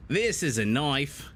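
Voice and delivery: a lame Crocodile Dundee Australian impression; bad Australian accent. Caster joking to co-caster in a bad Australian accent. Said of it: "my Australian accent is terrible".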